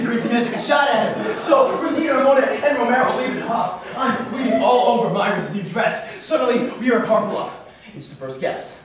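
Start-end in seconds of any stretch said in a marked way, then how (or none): none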